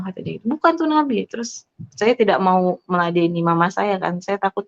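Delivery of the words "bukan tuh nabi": high-pitched retold denial; imitated contradiction. Mother rebutting her daughter, imitated contradiction.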